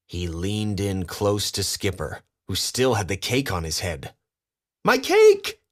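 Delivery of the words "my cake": very high, loud delighted shriek; joyful cry. A narrator's reading. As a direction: shriek with delight at the lost cake. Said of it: "he cried".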